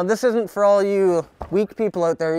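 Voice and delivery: ordinary talk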